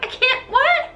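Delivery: high, incredulous squeal; mock bafflement. Everybody answering Christine, high mock bafflement. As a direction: pitch it up in comic disbelief.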